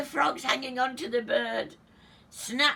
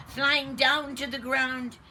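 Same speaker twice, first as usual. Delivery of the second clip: usual speaking voice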